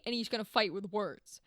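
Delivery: ordinary talk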